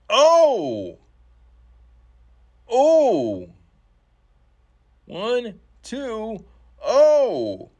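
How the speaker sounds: high, loud gasps on the near fall; excited shout. Real, not acted. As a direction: yell it like the pin almost counted. Near fall called live.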